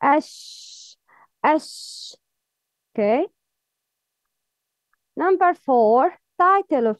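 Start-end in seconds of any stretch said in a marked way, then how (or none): 0.0-2.1 "ash" with a long, airy sh; audible breath. Shown as a demonstration. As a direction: stretch the sh of "ash" into running breath. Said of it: "You can hear the sound of breath".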